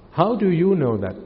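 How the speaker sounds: ordinary talk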